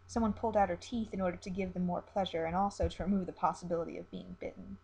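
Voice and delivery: ordinary delivery, nothing marked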